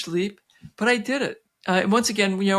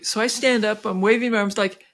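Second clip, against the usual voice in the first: ordinary talk, nothing marked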